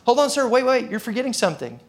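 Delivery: high-pitched, insistent halt; calling back urgently. Acted. Teller stopping the departing customer, calling out urgently.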